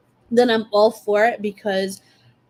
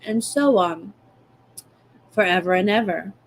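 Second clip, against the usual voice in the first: usual speaking voice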